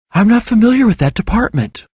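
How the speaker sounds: a receptionist puzzled by the request; female receptionist. A narrator's reading. Elizabeth replies on the phone.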